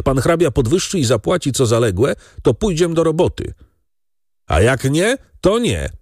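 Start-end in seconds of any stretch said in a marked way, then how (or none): 4.5-5.9 defiant cry. A narrator's reading. Second worker blurting an ultimatum to the lord, defiant cry. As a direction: throw it out sharply, pitch leaping. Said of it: "krzyknął drugi rozrzutnie".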